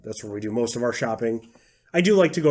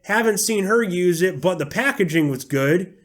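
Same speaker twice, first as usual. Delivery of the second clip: raised-pitch Southern reviewer voice; Southern accent; 5 semitones higher than usual. Acted. Mocking an Amazon reviewer who praises packaging, in a put-on Southern accent. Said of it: "I'm using a Southern accent".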